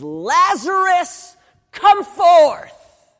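loud, high, slow command into the tomb; commanding call. Acted. Jesus calling Lazarus out of the tomb, booming command. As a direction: call the dead man out with full force.

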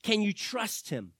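ordinary delivery

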